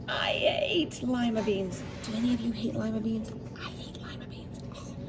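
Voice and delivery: ordinary delivery, nothing marked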